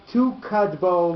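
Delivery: "tu kadbo" with the stress pulled back onto tu; accent on TU. Shown as a demonstration. Teacher demonstrates the rule, "tu kadbo" stressed on tu. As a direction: punch the first syllable, TU-kadbo. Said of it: "with the accent on tu".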